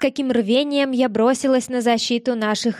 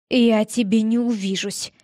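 hoarse grumble. Acted. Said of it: "буркнул хрипло".

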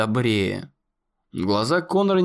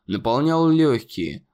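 plain reading voice

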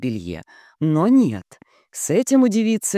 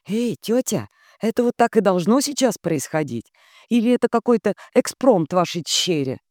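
put-on flustered young man; 6 semitones higher than usual.

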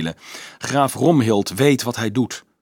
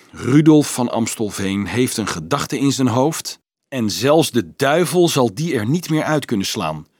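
ordinary delivery, nothing marked

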